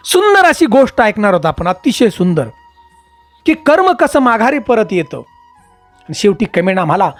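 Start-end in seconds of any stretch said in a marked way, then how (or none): none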